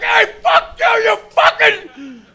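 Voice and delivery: put-on very high, loud drunk yell; mock angry shout. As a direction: yell back like a deaf drunk. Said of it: "I'm like".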